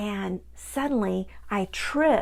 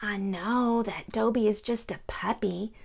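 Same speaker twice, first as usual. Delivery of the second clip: ordinary delivery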